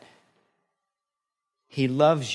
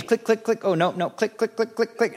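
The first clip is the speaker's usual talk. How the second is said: blinker clicks; 9 semitones higher than usual. Pretend. Mimicking turn signal.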